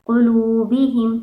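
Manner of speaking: कुलूबिहिम said slowly with लू stretched one alif; lengthened madd. Shown as a demonstration. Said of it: "कुलूबिहिम ऐसे पढ़ेंगे".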